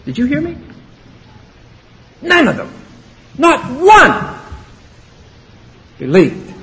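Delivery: emphatic challenge. Real, not acted. Preacher pressing the point hard.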